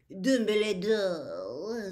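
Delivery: a grand imposing lady greeting him slowly; deep voice. A narrator's reading. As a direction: Madame Maxime drawing out his name, grand and loud. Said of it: "in a deep voice".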